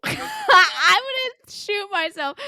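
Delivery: high, loud, slow through laughter; laughing. Real, not acted. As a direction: burst out laughing while talking. Bursts out laughing mid-discussion.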